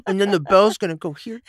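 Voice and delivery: put-on little baby voice, high and loud; squeaky-high little baby impression; Woodrow Wilson. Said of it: "what Woodrow Wilson sounded like. A little baby".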